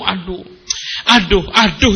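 lightly acted moan of complaint; mock complaining. Preacher imitating wives who grumble over chores, mock complaining. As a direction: groan it like a put-upon housewife. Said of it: "diselesaikan dengan ngeluh".

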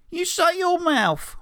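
put-on very high, slow, furious kid; Morty snapping at Mick, high and furious; Morty pipes up. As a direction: shriek it at the bully. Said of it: "Morty pipes up".

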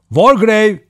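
loud, high call of a name; shouting. A narrator's reading. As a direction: shout to someone out of sight. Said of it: "diye bağırdı". (bağırarak seslenme)

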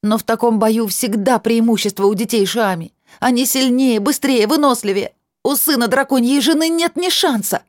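high, loud, voice straining; shouting. A narrator's reading. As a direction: yell until the throat tears. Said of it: "Нериль прокричала, срывая горло".

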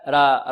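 را thin, with a front aa; را with no thickness; wrongly light raa. Shown as a demonstration. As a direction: show the mistaken light را. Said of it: "لا".

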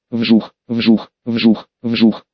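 low repeated whooshes; whooshing sound effect. A narrator's reading. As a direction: swish it like blades. Tornado of qi attacks.

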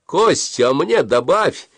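heckling yell at the stranger; jokey shout. A narrator's reading. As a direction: shout the joke across the queue. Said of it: "кто-то крикнул".

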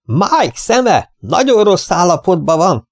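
slow, high-pitched calling. A narrator's reading. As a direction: spell it out slowly and high for deaf ears. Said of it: "Növeltem a hangerőt". (emelt hangon, lassan)

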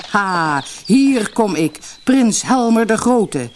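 high, loud triumphant self-announcement; boisterous, boastful cry; exuberant call. A narrator's reading. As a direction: proclaim yourself grandly, loud and drawn out. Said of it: "riep hij uitbundig".

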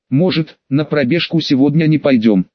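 ordinary delivery

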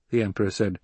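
ordinary delivery, nothing marked

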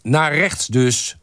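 high, slow called-back check; calling out. A narrator's reading. Group member checking the photographer's direction, calling out. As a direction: call it back, drawn out. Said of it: "riep Huub Pastoors omkijkend".

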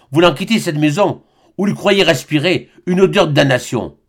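plain reading voice

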